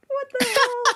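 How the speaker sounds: high, laughing disbelief. Real, not acted. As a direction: blurt it out through laughter. Laughing reaction to joke.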